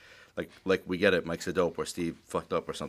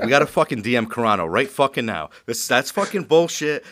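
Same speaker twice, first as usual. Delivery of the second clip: louder, heated re-enacted rant; retold yelling; 4 semitones higher, 6 dB louder than usual. Host retelling his angry phone rant, yelling. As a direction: punch it out, reliving the outburst. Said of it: "I was, like, fucking yelling".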